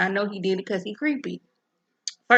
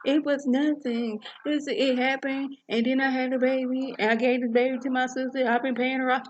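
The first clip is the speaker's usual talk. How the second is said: higher, pleading confession; high pleading wife; 6 semitones higher than usual. Retold or imitated. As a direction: plead your mistake up high. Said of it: "And she's like".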